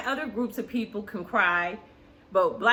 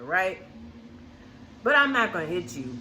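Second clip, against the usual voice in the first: usual speaking voice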